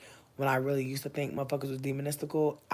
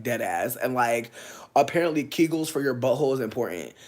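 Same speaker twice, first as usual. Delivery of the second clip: ordinary delivery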